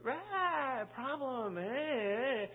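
high, whiny mock sigh of a worried person; a light parody of a fretful person; mock fretful complainer. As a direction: play someone fussing over a small problem. Mocking someone bringing a problem.

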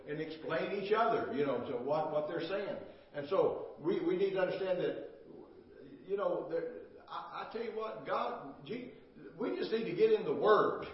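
full-force pulpit exhortation; fiery preaching. Acted. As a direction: preach it hard to the congregation.

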